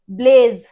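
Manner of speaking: ब्लेज with a long buzzing z at the end; teacher stretching the z of ब्लेज. Shown as a demonstration. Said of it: "ब्लेज ज आया".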